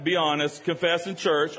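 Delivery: ordinary talk, nothing marked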